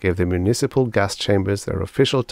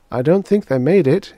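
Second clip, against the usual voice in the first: high, louder woman's voice for a query; receptionist character; 10 semitones higher, 6 dB louder than usual. A narrator's reading. Receptionist questions doctor about volunteers, high-voiced. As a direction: pitch it well up, brisk and questioning.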